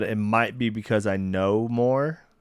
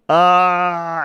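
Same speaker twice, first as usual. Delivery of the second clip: surprised; 9 semitones higher, 12 dB louder than usual. Real, not acted. Surprised at Rachel nominated.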